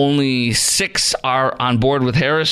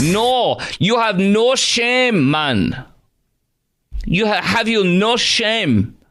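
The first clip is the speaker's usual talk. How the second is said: high, slow mock Jamaican accent, drawn out; 10 semitones higher, much slower than usual. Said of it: "here was her Jamaican".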